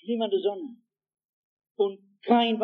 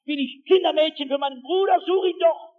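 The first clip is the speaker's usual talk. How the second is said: cheeky, fast, loud and higher, nearly shouted; defiant snap; 7 semitones higher, 5 dB louder than usual. Acted. Cain answers God defiantly, shouting fast and high. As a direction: throw it back at him in defiance. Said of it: "Er sagt patzig".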